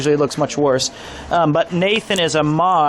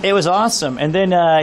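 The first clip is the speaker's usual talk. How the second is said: normal talking voice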